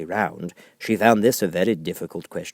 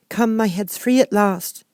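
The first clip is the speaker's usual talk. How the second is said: put-on high, slow, delighted little girl; a girl pleased her head is free; high slow happy exclamation; 13 semitones higher, 6 dB louder, slower than usual. A narrator's reading. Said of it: "said Alice in a tone of delight".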